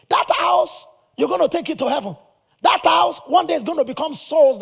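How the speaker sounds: high, loud exclaim on that house; rising indignation. Real, not acted. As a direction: stress disbelief at the friend.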